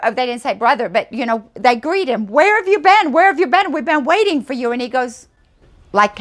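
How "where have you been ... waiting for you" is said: high, loud, overjoyed welcome; excited greeting. Retold or imitated. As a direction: greet him like a long-lost brother. Villagers greet the long-awaited visitor.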